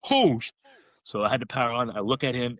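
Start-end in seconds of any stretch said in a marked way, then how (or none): 0.1-0.5 high, sudden cut-off curse; startled gasp. Retold or imitated. Hunter spotting the bull, startled high curse. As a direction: blurt it as the bull appears. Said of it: "and I'm like".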